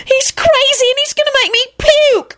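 very high, squealed wail; acted shrieking complaint. A narrator's reading. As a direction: wail it in horrified disgust. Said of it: "Rosie wailed".

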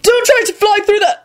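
high-pitched shouted warning, cut off; frantic bellow, breaking off mid-sentence. A narrator's reading. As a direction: yell it frantically. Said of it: "Neville bellowed".

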